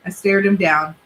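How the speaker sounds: ordinary delivery, nothing marked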